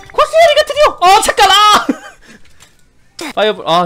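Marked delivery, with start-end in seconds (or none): 0.1-1.9 very high excited yelling; excited scream. Real, not acted.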